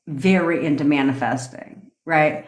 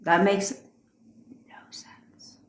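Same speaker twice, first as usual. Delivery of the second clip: fading to a whisper; 3 semitones higher, 3 dB quieter, slower than usual. Retold or imitated. Trailing into a whisper.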